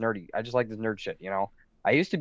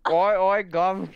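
loud, high mock cockney; cockney accent; 6 semitones higher, 5 dB louder, much slower than usual. Chiming in on friends' accent bit, loud mock cockney. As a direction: call out in a hammed-up cockney accent.

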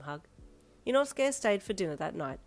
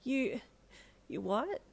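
halting, baffled question; falter, then question it; confused double take; taken aback; 6 semitones higher, slower than usual. Acted. Taken aback by his claim.

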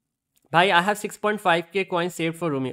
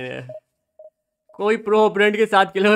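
usual speaking voice

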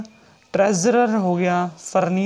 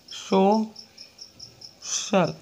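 extremely slow, drawn-out word; teacher dictating a word; slow dictation; much slower than usual. Shown as a demonstration. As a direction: stretch every sound. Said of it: "teacher dictating example word".

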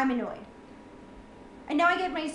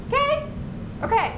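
exasperated okay; 13 semitones higher than usual. Real, not acted. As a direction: demand agreement, fed up. Venting at the audience.

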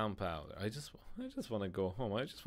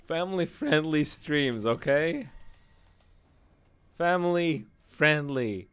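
raised, louder voice laying down a rule; emphatic high-pitched reminder to the audience; loud insistence; 6 semitones higher, 9 dB louder than usual. Real, not acted. Mock insistence on clean stream.

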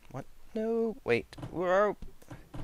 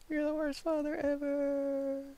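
much higher put-on child voice; bratty kid voice. Son telling his dad off in a high bratty kid voice. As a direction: whine it like a spoiled son.

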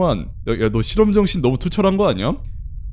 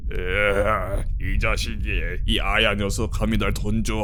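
lower, mock-angry growl of a thrown doll; 5 semitones lower, 5 dB quieter, slower than usual. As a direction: play the doll grumbling as it climbs back. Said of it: "하면서 따라오는 거 아니겠지?". (던져진 인형의 낮고 화난 흉내 목소리)

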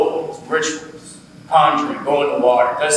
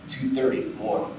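normal talking voice

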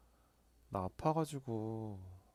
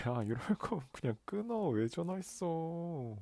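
mock angry; 7 semitones higher than usual. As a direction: pretend to hang up in a huff. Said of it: "아, 장난이지". (장난스럽게 화난 척)

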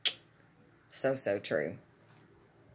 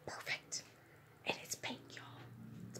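hushed, softer whisper; 16 dB quieter than usual. Real, not acted. Admiring a pink wallet, whispering softly to viewers. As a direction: breathe it out as a soft whisper.

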